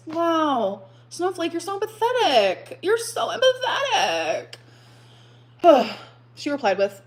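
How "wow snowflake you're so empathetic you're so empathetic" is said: higher, whiny mock-crying sarcasm; fake-sob the praise to mock it. Mocking a commenter's reply.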